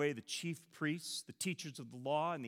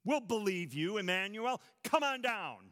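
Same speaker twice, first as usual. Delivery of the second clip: mocking, higher jeer; taunting; 6 semitones higher, 4 dB louder than usual. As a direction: goad him to come down. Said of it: "they taunted".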